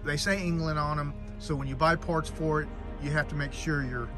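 ordinary delivery

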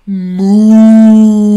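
loud, long-drawn moo; shouted moo. A narrator's reading. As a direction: belt out a big sustained moo. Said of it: "So he shouted".

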